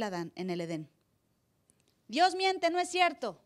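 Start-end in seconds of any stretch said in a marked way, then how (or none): 2.1-3.4 voz aguda y burlona de tentador; burla de Satán. Retold or imitated. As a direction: imita con sorna al diablo que niega la palabra. Pastor voicing Satan's lie. (el pastor imita a Satán que contradice a Dios, burlón)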